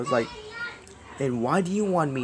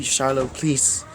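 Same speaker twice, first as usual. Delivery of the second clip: ordinary delivery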